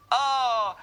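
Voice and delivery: loud, high, drawn-out "oh"; shocked shout. Real, not acted. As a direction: cry out loudly in alarm. Reacts to the poisoned character. (cri de stupeur fort et aigu)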